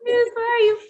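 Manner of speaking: high searching call; calling the dog. Retold or imitated. Searching the street for the dog, high call.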